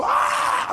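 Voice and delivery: short high breathy cry of panic; panicked scream. Retold or imitated. A driver skidding toward the wall lets out a high panicked cry. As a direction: yelp as the car skids. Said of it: "I go".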